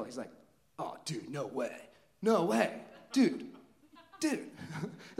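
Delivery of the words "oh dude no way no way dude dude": freaking out. Retold or imitated. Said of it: "freaking out".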